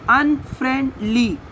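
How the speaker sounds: "अनफ्रेंडली" in three detached beats; split into syllables. Shown as a demonstration. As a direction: break "अनफ्रेंडली" at every syllable. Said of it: "अलग करना है".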